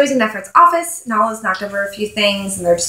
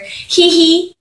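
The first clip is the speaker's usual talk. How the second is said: a high little giggle read off the page; mock giggle; 8 semitones higher than usual. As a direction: giggle "hee hee" high and silly. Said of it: "It's H E E space H E E".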